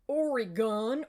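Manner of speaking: mock American saying "Oregon" with a long ee in the middle; mispronounced Oregon. Mocking Americans asked to name the state, "Oregon" stretched to OR-ee-gone. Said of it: "and they'll be like".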